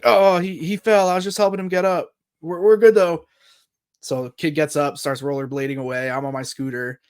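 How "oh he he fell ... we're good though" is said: higher, louder hurried cover story; acted innocent excuse. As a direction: play a kid caught red-handed, covering up. Kid covering when caught.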